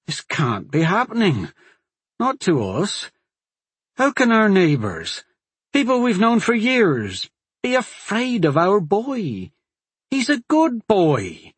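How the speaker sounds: a father pleads the sheriff for his son's sake, high and distressed. Acted. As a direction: plead for your son, voice climbing. Father pleads, wife in tears.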